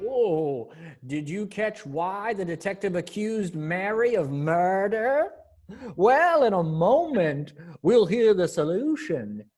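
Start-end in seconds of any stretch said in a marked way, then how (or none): none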